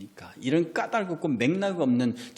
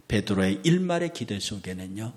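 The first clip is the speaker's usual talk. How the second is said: ordinary talk, nothing marked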